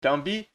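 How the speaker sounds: "don't be" run together as "don be"; dropped T. Shown as a demonstration. Teacher recapping the fast spoken form, T dropped from "don't". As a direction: omit the T at the end of "don't". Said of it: "geralmente comem esse T aqui".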